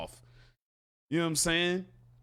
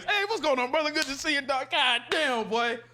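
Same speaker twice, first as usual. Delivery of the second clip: higher, overly friendly greeting; extra nice; 6 semitones higher than usual. Retold or imitated. Greeting the former rival, mock over-friendly cheer. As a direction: lay the friendliness on thick. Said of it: "I'm extra nice".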